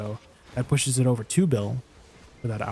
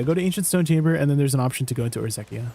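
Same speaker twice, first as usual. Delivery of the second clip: normal talking voice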